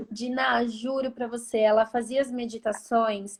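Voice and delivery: normal talking voice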